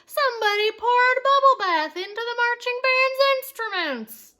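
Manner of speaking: high, loud outraged call; fan shouting. A narrator's reading. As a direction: yell it to the whole stadium. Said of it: "shouted a fan in the bleachers".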